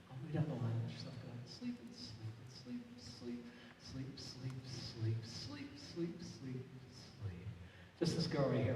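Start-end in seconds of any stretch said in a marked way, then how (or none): 0.1-7.5 soft, slow, sinking hypnotist voice; hypnotic sleep induction. Acted. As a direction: lull them down into sleep. Hypnotist lulls volunteers to sleep.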